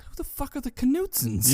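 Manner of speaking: high-pitched incredulous question; mock bafflement. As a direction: quote it high, totally baffled. Acted baffled question from film.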